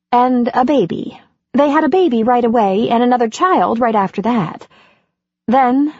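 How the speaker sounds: lightly voiced confiding heroine; Andy's voice. Andy recounts her past.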